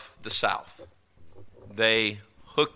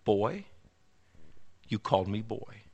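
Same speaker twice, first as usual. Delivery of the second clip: quiet challenge; 2 semitones lower, 8 dB quieter than usual. Retold or imitated. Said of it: "and he said".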